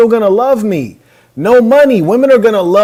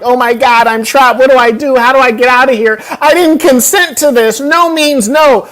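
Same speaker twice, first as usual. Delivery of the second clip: higher, panicky mock inner voice, slowing on no means no; mock frightened woman; 4 semitones higher than usual. Host mocking Adam's inner voice as a scared woman. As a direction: ham up a scared girl trapped on a date. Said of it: "do we walk around inside of our head like".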